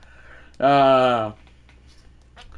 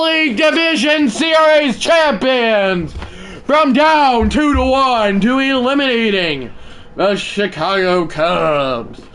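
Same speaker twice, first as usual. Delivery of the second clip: higher, louder shouted broadcast call; play-by-play call; 11 semitones higher, 6 dB louder, slower than usual. Acted. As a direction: call the winning catch like a TV announcer. Calls the series-winning out.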